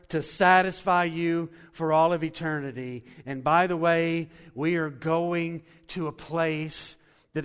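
loud, ringing pulpit delivery; fervent sermon climax, big and loud; full-force preaching. Acted. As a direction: preach it out to the whole room.

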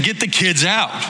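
disciples' brusque order. Retold or imitated. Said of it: "and saying".

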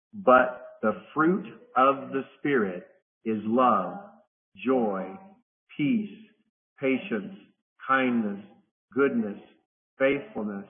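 very slow, each word spaced with pauses; slow recitation. Shown as a demonstration. Teacher leading the class through scripture, slow and spaced. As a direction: read it slowly so the group can say it along. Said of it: "So I'm gonna read it slowly".

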